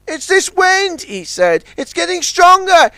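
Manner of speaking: high worried tug voice; Ten Cents. A narrator's reading. Small tug worrying about the wind, high.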